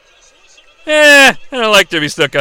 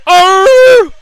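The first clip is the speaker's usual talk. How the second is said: high, loud wrestler's scream; imitated scream; 11 semitones higher, 7 dB louder than usual. Imitating the wrestler's classic bump scream. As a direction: let out a hammed-up pained roar. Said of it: "I love the scream".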